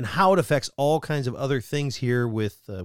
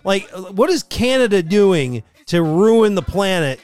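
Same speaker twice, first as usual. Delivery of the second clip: high, loud, drawn-out disbelief; mock astonishment; 7 semitones higher, 6 dB louder, much slower than usual. Co-host reacting to Detroit's heat, loud mock bafflement. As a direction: stretch it out, utterly baffled.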